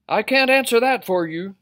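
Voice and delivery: high, loud starfish voice; starfish character. A narrator's reading. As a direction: give advice in a high character voice. Starfish answers the fish.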